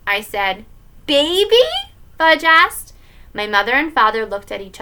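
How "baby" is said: high, loud, rising query; little kid's astonished question; surprised question. Acted. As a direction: blurt it out like a startled child. Fudge hears the news, startled.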